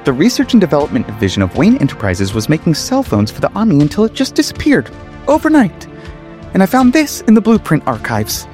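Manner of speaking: high, climbing pitch; insistent reveal. A narrator's reading. Reese revealing his findings to Lucius, insistent high voice. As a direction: build into a squeaky high revelation.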